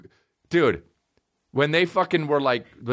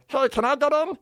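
lightly voiced [name]; 7 semitones higher, faster than usual. [name] cameo voice.

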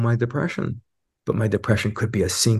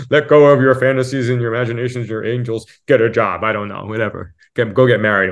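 dismissive advice; 2 semitones higher, 5 dB louder than usual. Retold or imitated. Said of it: "some people would say".

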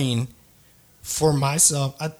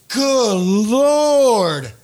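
awed exclamation; 4 semitones higher, 4 dB louder than usual. Real, not acted. Awed at the pitcher.

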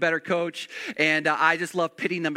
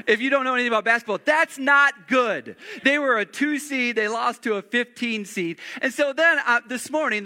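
normal talking voice

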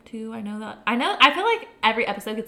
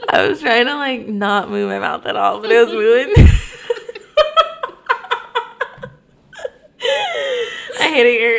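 giggling through the words; laughing so hard it cracks; 4 semitones higher, 11 dB louder than usual. Real, not acted. Laughing fit after teasing.

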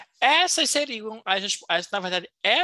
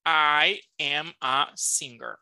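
slow model sentence; 4 semitones lower, much slower than usual. Shown as a demonstration. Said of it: "teacher models sentence slowly".

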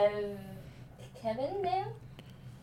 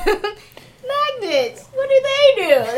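laughing; 13 semitones higher, 9 dB louder, much slower than usual. Retold or imitated. Quoting a meme song.

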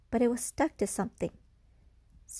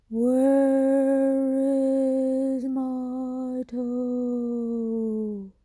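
slow, drawn-out ghostly moan, higher and louder; ghostly groan; 5 semitones higher, 6 dB louder, much slower than usual. Acted. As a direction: stretch every word like a ghost calling from the dark. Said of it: "it groaned".

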